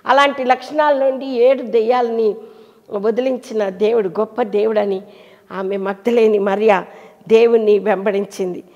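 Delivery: ordinary delivery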